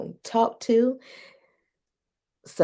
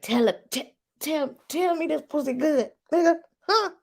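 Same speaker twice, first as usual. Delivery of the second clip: high, breathy-sounding playful bedroom voice; pitched way up, teasing; mock moaning request; 9 semitones higher than usual. Woman teasing her partner in bed, high mock moan. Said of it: "I'd be like".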